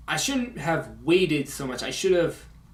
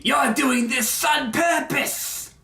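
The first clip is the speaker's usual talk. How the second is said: angry villain, high and slow with exaggerated S; Cobra Commander; 10 semitones higher, 3 dB louder, much slower than usual. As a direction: rage theatrically, stretching out each word. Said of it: "He's getting angry".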